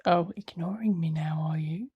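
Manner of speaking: loud, teasing mock offence; mock offended. Real, not acted. Owner to the dog that ignores them, mock offended. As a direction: tease a pet with pretend hurt.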